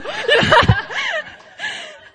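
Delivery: loud, high squealing laugh; high burst of laughter. Real, not acted. Preacher laughing at own punchline, high squealing laugh. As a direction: crack up at your own story.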